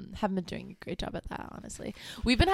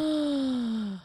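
gasp; 5 semitones higher than usual. Real, not acted. Shocked at the best movie.

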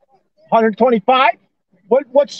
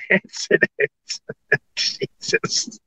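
words lost in wheezing laughs; breaking into laughter; 5 dB quieter, slower than usual. Real, not acted. Caller retelling the deductible news, breaking up laughing. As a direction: crack up mid-word, voice giving out.

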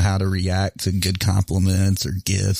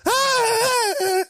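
imitated startled shriek. Mom opens the pop box, imitated shriek. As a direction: shriek like a surprised mom in falsetto. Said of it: "She goes".

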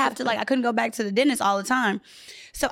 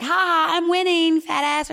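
taunting; 5 semitones higher than usual. Retold or imitated. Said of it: "I was like".

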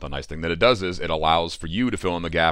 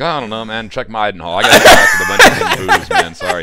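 put-on drunk dad voice; 4 semitones higher, 10 dB louder than usual. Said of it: "he's like".

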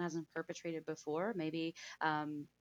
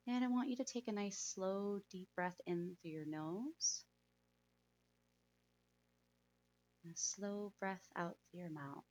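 soft spa whisper; 8 dB quieter than usual. Acted. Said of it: "let's go ahead and meditate".

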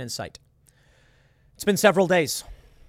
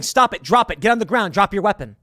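raised, higher voice, urgent commands; shouted orders; 5 semitones higher, 7 dB louder than usual. Acted. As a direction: order an armed stranger to disarm. Acts out armed man's orders.

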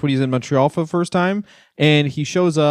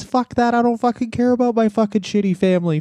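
higher pitched hammed-up defiant wrestler; mock defiant rant; 6 semitones higher than usual. Wrestler snubbing his family, hammed-up defiant outburst. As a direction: spit it out like a cocky heel quitting. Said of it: "He says".

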